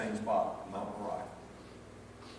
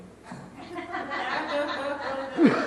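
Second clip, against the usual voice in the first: high delighted laugh; 14 semitones higher than usual. Real, not acted. Preacher laughing at his grandkids story, high laugh.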